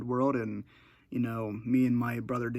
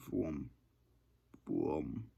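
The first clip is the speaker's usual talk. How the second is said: imitated low booming; 8 semitones lower, 10 dB quieter than usual. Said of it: "the vibrations I would hear".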